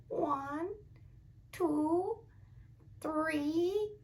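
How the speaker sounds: put-on high, soft old lady counting. Shown as a demonstration. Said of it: "like an old lady".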